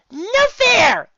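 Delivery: put-on very high, loud kid protest; indignant yell. A narrator's reading. Pony losing snowball fight, indignant yell. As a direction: shout it like a cheated child. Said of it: "I yelled".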